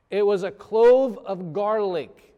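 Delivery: loud, high, drawn-out disbelief; comic horrified announcement; outraged reveal. Acted. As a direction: belt out the punchline, slowly. Big comic reveal of the garlic.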